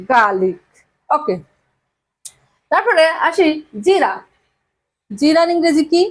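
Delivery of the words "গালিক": গার্লিক with the r silent, "gaa-lik"; r-less pronunciation. Shown as a demonstration. Teacher telling students not to sound the r, গার্লিক said r-less. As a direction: say গার্লিক low and falling, long "aa" and no r. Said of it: "আর এর উচ্চারণ".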